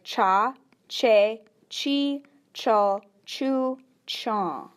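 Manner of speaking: "cha, che, chi, cho, chu, chon" said one by one, each with a clear ch; Oneida ch syllables. Shown as a demonstration. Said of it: "it's gonna sound like".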